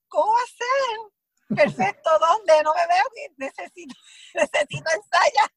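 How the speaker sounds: high, disbelieving plea broken by laughs; half-laughing "what do you mean, perfect?"; incredulous protest. Retold or imitated. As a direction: sound shocked that the take is already over. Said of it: "Y yo".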